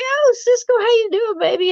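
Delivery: high-pitched, gushing friend's hello, imitated; effusive greeting. Medium friend greeting a client at a reading, effusive and high.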